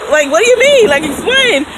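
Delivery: imitating the friend asking her to explain; friend demanding. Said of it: "he was like".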